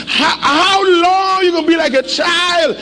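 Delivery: rising preacher's shout; preaching shout. Real, not acted. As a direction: call it out over the crowd. Preacher crying out to congregation.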